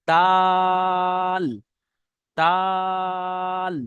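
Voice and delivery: দাল held very long and loud; long dal. Shown as a demonstration. Teacher models long stretched dal for online students. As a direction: stretch দাল for three beats. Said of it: "তিন আলিফ পরিমাণ টান".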